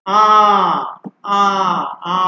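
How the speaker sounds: each আ a drawn-out open "aah"; long open আ. Shown as a demonstration. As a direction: say আ long with a wide-open mouth. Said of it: "খোলা মুখে দীর্ঘ আ বলতে হবে". (খোলা মুখে দীর্ঘ আ)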